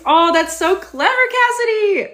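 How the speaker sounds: high, loud delighted praise; thrilled to a viewer. Real, not acted. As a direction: gush over the clever name. Delighted at a viewer's cat names.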